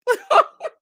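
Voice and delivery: high shrieking laugh that breaks loose; burst of laughter. Real, not acted. Host laughing to her stream audience, a high shriek. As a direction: laugh out loud at the memory.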